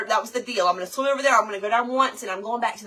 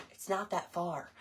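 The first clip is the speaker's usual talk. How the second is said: muttered to self; 4 semitones lower, 12 dB quieter than usual. Retold or imitated. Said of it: "I'm like".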